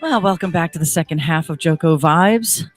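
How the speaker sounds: ordinary talk, nothing marked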